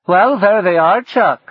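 a mocking announcement; [name], wry. [name] greets the approaching navy, high and loud.

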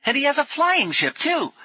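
put-on high boyish excitement; thrilled young boy's voice; delighted. A narrator's reading. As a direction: cry out in delight.

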